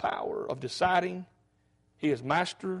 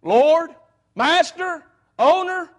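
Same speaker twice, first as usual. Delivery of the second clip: loud and much higher on each word; preacher crying out the titles of Christ; proclaimed; 9 semitones higher, 7 dB louder, much slower than usual. Real, not acted. Preacher exclaims the names rising.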